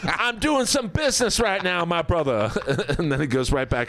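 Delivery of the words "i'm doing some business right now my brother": Gary Hart brushing off. Retold or imitated. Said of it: "Gary Hart's like".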